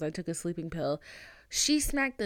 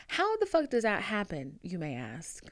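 ordinary delivery

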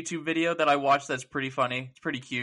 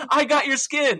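put-on high, louder child insisting; excited kid; 12 semitones higher, 5 dB louder than usual. Kid in the game explaining his skin, high and excited. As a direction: squeak it out like a bragging kid. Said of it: "And he's like".

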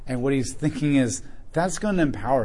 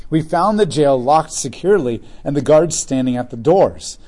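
raised, measured Bible reading voice; scripture reading; 5 dB louder than usual. Acted. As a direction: read the passage out to the church. Reads scripture aloud to congregation.

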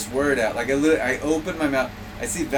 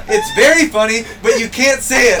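loud, raised-pitch retort; hammed-up loud objection; retold scolding; 9 semitones higher, 8 dB louder than usual. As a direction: fire back high and loud, imitating her.

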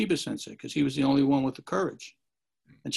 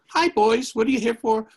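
put-on cheerful old master; 8 semitones higher, 7 dB louder than usual.

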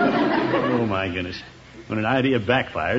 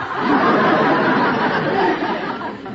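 high unvoiced giggle; a gleeful high laugh; delighted giggle. Gildersleeve giggling after Peavy gives in, high and delighted.

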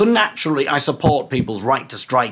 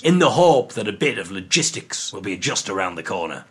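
ordinary talk

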